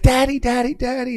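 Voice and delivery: high, loud imitated child's cry; little daughter. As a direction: call out like a frantic little girl. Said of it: "His little daughter ran out".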